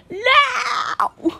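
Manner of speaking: a high, loud cry of no; shrieking no. Real, not acted. Gamer shrieking at a sudden scare, high and loud. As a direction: shriek it out in shock.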